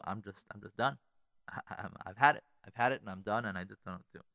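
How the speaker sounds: low, soft, worn-out voice, trailing to almost nothing; a man at the end of his rope, barely getting the words out; defeated caller. Retold or imitated. Desperate husband confessing his marriage is over, quiet and defeated.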